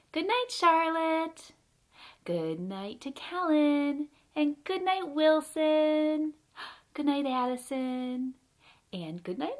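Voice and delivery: drawn-out, lilting goodnight calls; singsong goodnights. Pretend. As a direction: stretch each name in a gentle singsong. Bedtime goodnights to named children.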